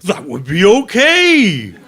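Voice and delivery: loud, high, drawn-out reassurance; eager consent. Acted. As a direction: blurt out your delight at the idea. Eager consent to being bitten.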